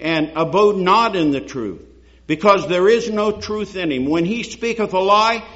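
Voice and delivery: ordinary talk, nothing marked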